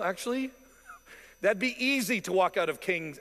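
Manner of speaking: normal talking voice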